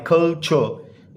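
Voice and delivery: Culture drawn out as two syllables, कल–चर. Shown as a demonstration. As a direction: break culture slowly into कल and चर. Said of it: "टू syllable word है".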